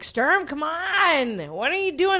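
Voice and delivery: high, drawn-out imitated call; impatient calling. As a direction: call a dawdling friend along, stretching it out. He calls her out to van.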